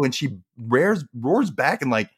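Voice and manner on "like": ordinary delivery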